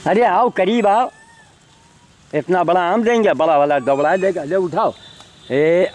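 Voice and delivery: high, eager call to come closer; coaxing call. Real, not acted. Calling monkeys over to take a big mango, eager coaxing. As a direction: coax the animals in with a lifted voice.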